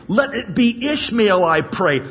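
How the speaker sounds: emphatic plea. Acted. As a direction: insist on it. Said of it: "He says emphatically".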